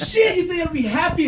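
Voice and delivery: high, loud, laughing indignation; laughing mock outrage. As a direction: yelp it through a laugh. Recounting premature finish.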